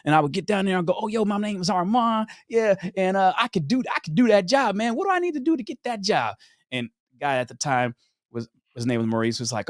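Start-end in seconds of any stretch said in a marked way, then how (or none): 1.0-6.4 high-pitched chummy self-introduction; acting out a keen go-getter; eager sales pitch. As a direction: pitch yourself eagerly for the job. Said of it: "what's my sales pitch on this one".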